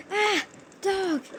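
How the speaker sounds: high startled cry of dog; a robber startled by the barking dog, high yelp; scared yelp. Retold or imitated. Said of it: "He got scared".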